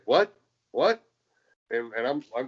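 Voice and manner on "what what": incredulous, sharply rising question, high and loud; baffled disbelief. Retold or imitated. Tag partner handed a mic before the match, baffled disbelief. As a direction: blurt it out, pitch shooting up. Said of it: "McCabe's like".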